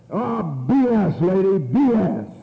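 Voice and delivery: high, slow, drawn-out scoff; mock heckling. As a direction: heckle her in exaggerated disgust. Acts out heckling the speaker.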